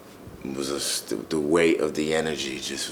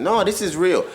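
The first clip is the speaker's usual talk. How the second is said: a sharp inner jolt, higher and louder; sudden realization; 13 semitones higher, 6 dB louder than usual. Retold or imitated. Someone realizing inside that it is real, startled. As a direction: voice the moment it hits you. Realizing truth within oneself.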